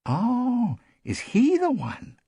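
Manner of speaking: high, drawn-out dawning realization; surprised realization. A narrator's reading. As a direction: let it dawn on you in a high voice. Guest suddenly realizes who he is.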